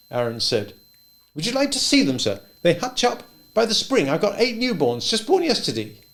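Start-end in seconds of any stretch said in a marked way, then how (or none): 1.3-6.0 put-on higher, eager young boy voice; a shy boy showing off his rabbits. A narrator's reading.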